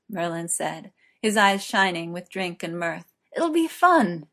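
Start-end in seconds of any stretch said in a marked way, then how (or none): 3.4-4.3 giddy tipsy. Acted. Drunk Merlin urging.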